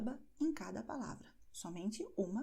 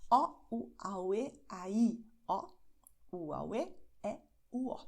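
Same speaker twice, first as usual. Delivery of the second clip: vowels separated by little pauses; slower demonstration. Shown as a demonstration. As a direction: spell out the vowel sentence piece by piece. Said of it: "Eu vou repeti-la mais devagar". (mais devagar, vogais separadas)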